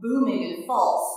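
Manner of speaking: ordinary delivery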